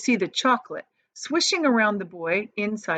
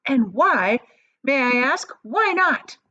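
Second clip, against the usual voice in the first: louder, indignant demand; shouting; 6 semitones higher, 5 dB louder, slower than usual. A narrator's reading. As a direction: demand an answer, furious. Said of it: "shouted Missus Gloop".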